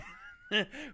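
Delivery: short amused laugh. Real, not acted. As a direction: burst of high laughter. Laughs at his own rant.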